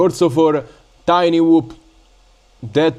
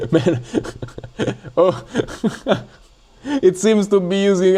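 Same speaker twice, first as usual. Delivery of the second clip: laughing at the bugs; 5 semitones higher, slower than usual. Real, not acted. Software bugging out, laughing.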